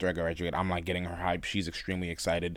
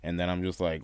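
usual speaking voice

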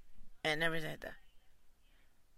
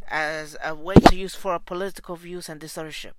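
angry outburst; 12 dB louder than usual. Real, not acted. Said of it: "I'm fucking pissed right now".

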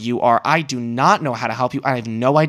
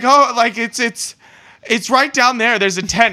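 much higher, flustered stammering voice; flustered man; 8 semitones higher than usual. Retold or imitated. Imitating a nervous man pointing to the tent, high flustered voice. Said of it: "the guy's like".

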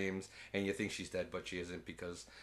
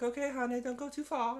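mock mum voice; 14 semitones higher than usual. Parent voiced in retelling.

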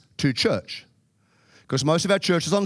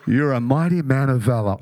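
God voice; 3 semitones lower than usual. Acted. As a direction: announce it like God, grand. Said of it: "God speaking to Gideon".

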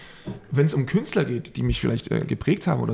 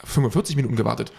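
usual speaking voice

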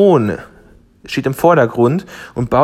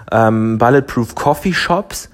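normal talking voice

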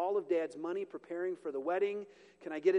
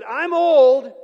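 blurted out much louder; a sudden loud comic cry of age; mock old-man complaint; 6 semitones higher, 19 dB louder than usual. Zechariah grumbling to the angel, a loud comic blurt. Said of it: "he just said".

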